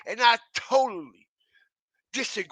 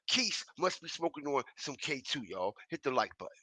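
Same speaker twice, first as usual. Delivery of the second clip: quiet aside; 8 semitones lower, 11 dB quieter than usual. Real, not acted. Aside to viewers, dropping voice.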